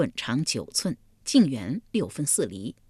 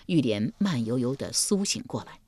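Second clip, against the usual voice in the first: ordinary delivery, nothing marked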